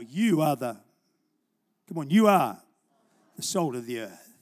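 booming preacher's call; loud preaching. Acted. Preacher urging the congregation, loud and fervent.

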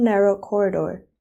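ordinary delivery

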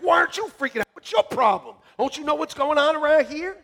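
loud high exasperated yell; freaking out. Retold or imitated. Pastor yelling at calm people, loud exasperated. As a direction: demand why nobody panics.

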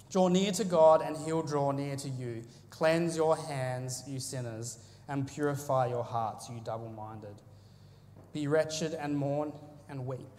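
measured solemn Bible reading; scripture reading. Acted. Preacher reading James to the congregation, solemn and measured.

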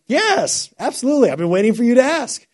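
high, gushing mock-eager yes; eager acceptance. Bob eagerly accepts a prank offer.

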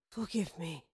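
very soft, low plea; faint voice with little strength left; weak. A narrator's reading. Dying queen begging forgiveness, weakly. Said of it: "she said weakly".

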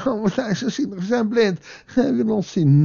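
high, whiny mock-victim voice; mock self-pity. Preacher mocking blind men playing victim, high-pitched self-pitying whine. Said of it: "niet dat zij hier in een slachtofferrol zitten".

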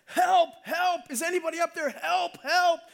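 high, loud acted cry for help; yelling for help. Man hanging from a branch calls for rescue, high yelling. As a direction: call up out of the canyon. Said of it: "he yells out".

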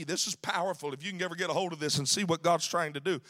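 ordinary delivery

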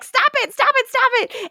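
high, pleading repeated stop it; mock desperate begging; mock frantic pleading. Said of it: "And Stefan is like".

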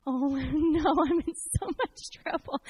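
drawn-out, hammed-up "uh-oh" wail; comic dread. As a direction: play up the dread of being in trouble. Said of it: "and I'm like".

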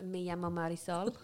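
low, soft put-on Spanish accent. Co-host joining the Spanish game, soft Spanish accent. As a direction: introduce yourself in mock-Spanish, half laughing. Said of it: "I love speaking in an accent".

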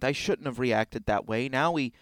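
ordinary delivery

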